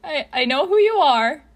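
high, loud, nervous stammer; wobbly voice. A narrator's reading. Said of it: "he says in a wobbly voice".

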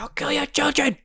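imitating an angry dad; mock threat. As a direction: threaten in a silly high voice. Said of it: "And he's like".

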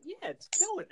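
high, pleased with himself; proud answer to his sister; giddy boast. Retold or imitated. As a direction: crow about it. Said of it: "I'm like".

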